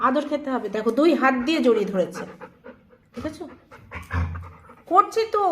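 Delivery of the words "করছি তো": raised protest. Real, not acted. Owner scolded by clingy dog.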